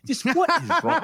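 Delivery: high, laughing mother; exasperated mom voice. Retold or imitated. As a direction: cry out like a baffled mom. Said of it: "she goes".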